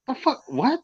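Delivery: mock bewildered, can't believe it; baffled. Wrestler retelling his disbelief at the partners, high and hushed. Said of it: "I'm like".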